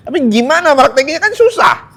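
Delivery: high, fast mock complaint; whiny objection. Preacher mimicking a listener who finds practice hard, whiny. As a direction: squeak out the objection like a doubter.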